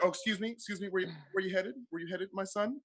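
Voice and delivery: soft, hushed, kindly check-in voice; gentle Jesus. Acted. Jesus greeting the line at heaven's gate, soft and kind. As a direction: welcome each soul gently at the gate. Jesus stopping sneaking comedian.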